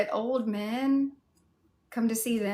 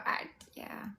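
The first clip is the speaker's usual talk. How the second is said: whisper; 8 dB quieter than usual. Real, not acted. Whispered aside to chat.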